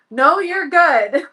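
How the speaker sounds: high, loud, drawn-out retold reassurance with a laugh; slow it down, half laughing through tears; tearful reassurance. Mom hiding tears from her son, tearful reassurance. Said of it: "tearing up".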